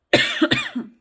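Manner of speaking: short dry cough. Real, not acted. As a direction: cough once, dry and sharp. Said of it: "Excusez-moi, je tousse encore un peu".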